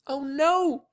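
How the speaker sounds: high-pitched mock scream of dismay; horrified girl's cry, not very loud. A narrator's reading. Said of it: "Sheila screamed".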